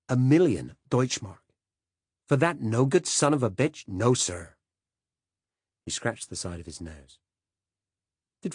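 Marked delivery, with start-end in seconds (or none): 0.1-4.6 rising, louder disbelief at the sum; incredulous, raised voice; shocked outburst. Acted. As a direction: blurt it out, appalled.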